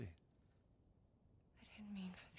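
barely voiced, very slow; slow broken whisper. Bitten Tess confessing to Joel, slow whisper. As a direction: confess it almost without breath.

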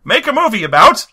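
high-pitched, hammed-up gangster voice; Quentin Tarantino. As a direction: squeal it like a delighted old-time gangster. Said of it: "Quentin Tarantino rolls his dice".

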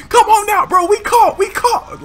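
high, loud call broken by laughs; calling out laughing. Retold or imitated. Kid calling Casey out again, loud laughing shout. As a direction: shout for him while cracking up.

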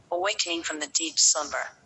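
plain reading voice

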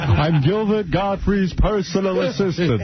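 high, put-on phone voice; disguised voice. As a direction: fake being his own assistant, slow. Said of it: "call in a disguised voice".

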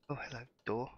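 usual speaking voice

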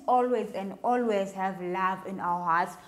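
ordinary talk, nothing marked

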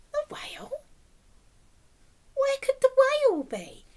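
usual speaking voice

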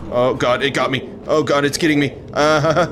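high, loud panicked cries; screaming as it grabs you; terrified horror victim. Real, not acted. Caught by the monster.

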